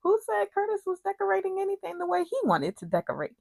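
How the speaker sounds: high, fast indignant challenge; indignant retort. Real, not acted. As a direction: fire it back high and quick, exasperated. Indignant retort to the retold questioning.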